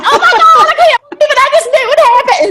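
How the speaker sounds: thrilled Southern gossip, laughing; Southern accent. Retold or imitated. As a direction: squeal with delight in a thick Southern accent. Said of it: "you start getting that accent".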